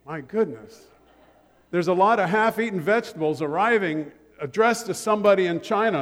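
ordinary delivery

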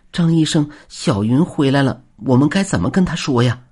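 high, anxious nurse asking; worried. Acted. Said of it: "担忧地问道".

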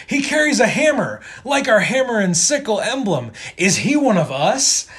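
high, loud hammed-up soldier; excited comic-book soldier ending on a question; astonished soldier. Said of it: "the first soldier that sees Thor come through the door".

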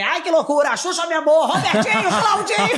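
high, loud laughing catchphrases; imitating Narcisa. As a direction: shriek her catchphrases gleefully. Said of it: "agora ela grita pra mim". (bordões gritados imitando a Narcisa)

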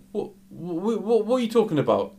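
high, stammering disbelief, imitated; mock incredulous protest. Customer told to leave answers back, acted incredulous. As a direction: imitate a man spluttering back, high and quick.

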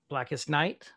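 normal talking voice

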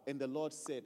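normal talking voice

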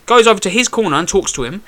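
usual speaking voice

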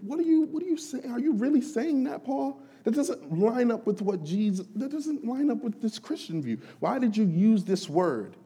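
high, pressing disbelief at Paul; incredulous challenger. Retold or imitated. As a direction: needle Paul like a doubting skeptic. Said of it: "He said".

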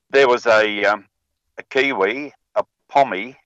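normal talking voice

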